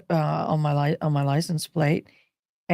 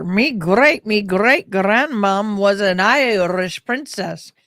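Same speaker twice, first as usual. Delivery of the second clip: lightly put-on Irish lilt, slow and proud; Irish accent played for a joke, drawn out; mock Irish brag; 2 semitones higher, 5 dB louder, slower than usual. Viewers hear her mock Irish princess boast in Irish accent.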